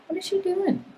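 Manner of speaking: surprised. Real, not acted. Reacting to something off camera.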